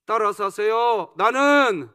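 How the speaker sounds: loud, commanding call to the congregation; call to repeat. Real, not acted. Preacher prompting the congregation to repeat after him, loud call. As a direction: call it out for everyone to echo.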